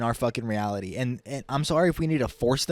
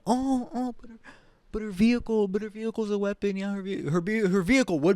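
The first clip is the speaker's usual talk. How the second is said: much higher, mocking sing-song; sarcastic mock excuse; 7 semitones higher than usual. Mocking the claim her car was a weapon, high sarcastic voice. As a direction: sneer at the official excuse.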